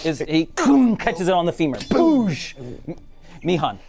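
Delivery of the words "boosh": high, punchy impact "boosh"; imitated impact noise. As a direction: make the sound of a blow landing. Imitates the hit landing.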